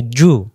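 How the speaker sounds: জু with a firm, hard j. Shown as a demonstration. Teacher showing the letter jim, hard and firm. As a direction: press the j firmly in জু. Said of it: "জিমের বেলায় শক্ত করে".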